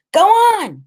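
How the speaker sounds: high, loud urging shout. A narrator's reading. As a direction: yell down from up on his shoulders. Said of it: "he's shouting from above my head".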